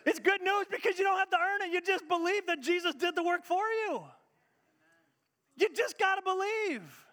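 fervent preaching. Real, not acted. Preacher urging the congregation, high and loud. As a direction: preach it with full force.

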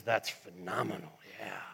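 low, soft voice of God, pleased; hushed admiration. Acted. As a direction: savour it low and quietly. Voices God admiring his creation.

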